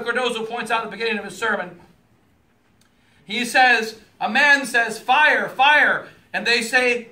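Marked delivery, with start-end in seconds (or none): 5.0-6.1 raised alarm call of fire; shouting fire. Retold or imitated. A man warning the crowd, raised shout. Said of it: "Don't talk so loud".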